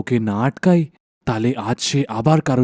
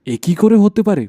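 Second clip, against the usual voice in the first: high and louder in disbelief; shocked question; 8 semitones higher, 5 dB louder than usual. Acted. Husband to wife over her long hair, shocked. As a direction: gasp it out in amazement.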